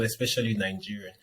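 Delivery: ordinary talk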